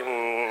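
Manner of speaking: low, sustained praying hum; vibrating hum. Retold or imitated. Pastors praying in vibrate mode, low hum. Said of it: "quedan en modo vibrador".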